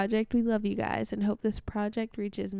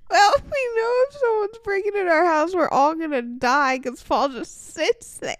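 high, loud, near-crying complaint; tearful panic; 5 semitones higher, 7 dB louder than usual. Real, not acted. Host complaining nobody would save them, loud tearful panic. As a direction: wail it out, half crying from the scare. Mock panic after the scare.

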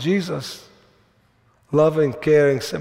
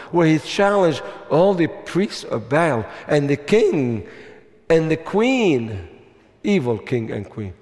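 ordinary talk

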